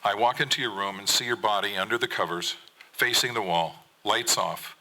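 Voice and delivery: normal talking voice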